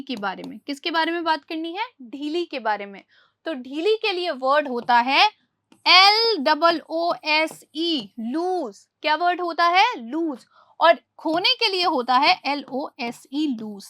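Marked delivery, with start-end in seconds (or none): 5.9-8.9 slow letter by letter spelling; spelling aloud. Shown as a demonstration. Teacher spells loose for pupils, letter by letter. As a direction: spell each letter out clearly. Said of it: "teacher spells the word letter by letter".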